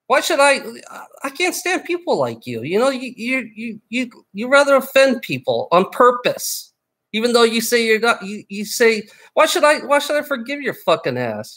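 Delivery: angry rant. Real, not acted. Ranting at viewer who misspelled name.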